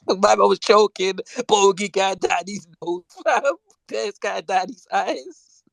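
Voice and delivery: high, laughing retelling. Real, not acted. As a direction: laugh through the story.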